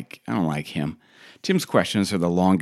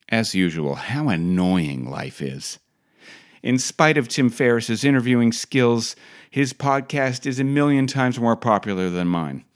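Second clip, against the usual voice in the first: ordinary talk, nothing marked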